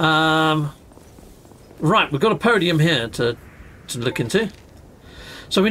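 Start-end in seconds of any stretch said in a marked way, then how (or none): none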